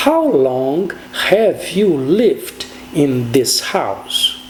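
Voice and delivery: very slow, deliberate English question; slow model question. Shown as a demonstration. Teacher modelling the how long question, very slow. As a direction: spell out the question slowly. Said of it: "essas perguntas seriam".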